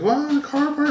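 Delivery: high mock voice of the upset wife; husband mimicking his upset wife. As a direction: squeak it like the fuming wife. Wife voiced, car missing.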